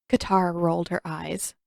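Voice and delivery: ordinary delivery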